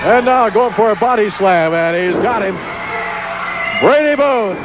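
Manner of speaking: high, fast ringside call of the match; excited play-by-play. Real, not acted. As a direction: call every move like a ringside announcer.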